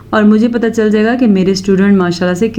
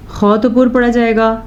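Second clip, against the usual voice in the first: ordinary talk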